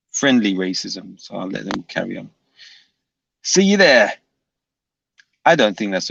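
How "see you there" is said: high, loud Cockney-style challenge; Brian Hurl accent. Acted. Streamer mimicking Brian to viewers, Brian Hurl accent. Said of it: "a Brian Hurl accent".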